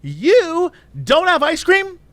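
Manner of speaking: high-pitched, outraged customer; indignant Karen. Retold or imitated. Woman complaining to drive-through cashier, indignant. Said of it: "she's going full Karen".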